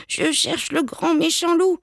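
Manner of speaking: put-on high, timid little-kid voice; faltering child. A narrator's reading. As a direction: answer like an unsure small child. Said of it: "bredouille Petit Biquet".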